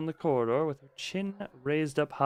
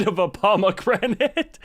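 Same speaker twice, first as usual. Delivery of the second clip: laughing through the words; 11 semitones higher, 6 dB louder than usual. Real, not acted. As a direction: crack up mid-sentence. Cracking up at own joke.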